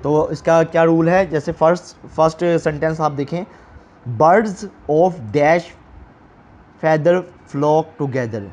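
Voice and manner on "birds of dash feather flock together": slow, deliberate reading of the exercise; measured classroom dictation; slow textbook read. Shown as a demonstration. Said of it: "reading exercise sentence slowly".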